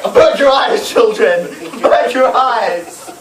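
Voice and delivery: high, loud horrified cry; scandalized parent. Retold or imitated. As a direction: shield the kids in shock. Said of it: "parents are just like".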